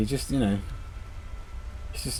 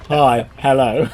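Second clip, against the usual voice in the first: slow, louder, a lightly voiced stiff professor; mock curt greeting; 8 dB louder, much slower than usual. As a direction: drag out a stiff, curt hello like a bored academic. Mocking the brief chat with Dawkins.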